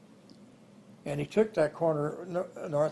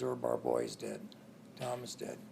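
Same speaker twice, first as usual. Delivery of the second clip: grieving, crying; 6 semitones lower, 13 dB quieter than usual. Retold or imitated. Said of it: "standing on the corner crying".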